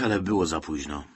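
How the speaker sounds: plain reading voice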